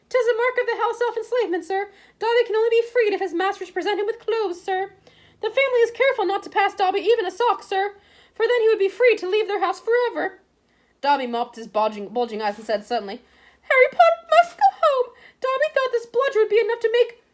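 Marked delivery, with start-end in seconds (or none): 0.1-10.4 high, squeaky, resigned elf voice; meek house elf. A narrator's reading. House elf answering Harry's threat, squeaky and meek. 13.6-17.2 high, loud, squeaky elf voice near tears; desperate house elf. A narrator's reading. House elf urging Harry to leave school, desperate and squeaky.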